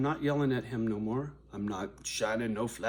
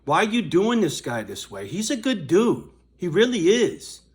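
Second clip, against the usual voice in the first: loud, higher mock caller telling him off; scripted call for fans; 6 semitones higher, 7 dB louder than usual. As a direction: play a fan scolding the manager, loud.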